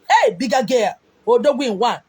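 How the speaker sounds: usual speaking voice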